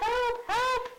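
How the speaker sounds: high shrill mock cry for help; hammed-up frightened queen calling help; panicked queen. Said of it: "The queen says".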